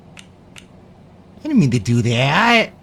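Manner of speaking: slow, drawn-out whiny parody; mocking echo. Mocking his friend's excuse in a slow drawn-out whine. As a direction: stretch every word like a sulky kid.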